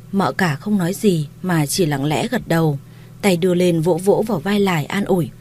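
ordinary delivery

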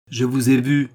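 cold insistence. A narrator's reading. Charny repeats his accusation to the queen, coldly insistent. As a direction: press the charge coldly. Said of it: "répéta-t-il froidement".

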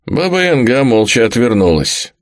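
ordinary delivery

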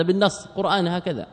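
ordinary talk

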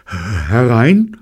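loud, hesitant call rising high; nervous call. A narrator's reading. Robert answering a knock at the door, nervous call. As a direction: call out uncertainly, voice climbing.

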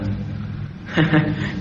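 soft airy chuckle at a silly band name; quiet giggle at a joke; breathy laugh. Acted. Joking about band names.

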